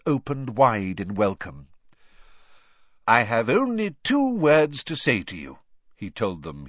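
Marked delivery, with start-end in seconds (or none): 3.1-5.6 loud, higher, projected address; Dumbledore. A narrator's reading. Headmaster addressing the students, loud and projected. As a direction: declare it to the whole hall. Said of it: "his deep voice echoing".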